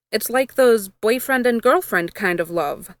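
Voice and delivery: ordinary delivery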